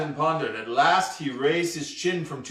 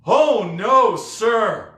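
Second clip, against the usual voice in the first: put-on high, loud boyish protest; horrified refusal; 7 semitones higher, 6 dB louder, much slower than usual. A narrator's reading. Boy rejecting the pirate's witch idea, horrified. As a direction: refuse it outright in horror. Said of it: "The thought horrified J D".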